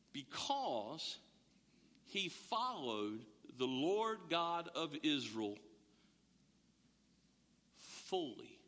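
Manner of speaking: slow, low, hushed "because ... fully"; weighty emphasis. Shown as a demonstration. Preacher stressing why Caleb got Hebron, slow and low. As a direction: drop low and linger on "fully". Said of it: "and this, this is critical".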